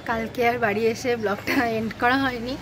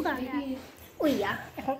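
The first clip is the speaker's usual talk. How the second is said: ordinary talk, nothing marked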